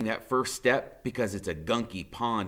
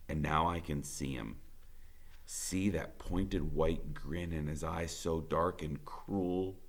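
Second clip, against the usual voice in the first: tense hush; 6 semitones lower, 10 dB quieter than usual. A narrator's reading. Tense narration of villain.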